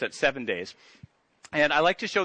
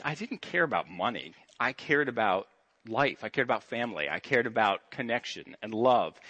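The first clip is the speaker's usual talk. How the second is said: sincere and quiet; 5 semitones lower, 4 dB quieter than usual. Real, not acted. Realizing what truly matters.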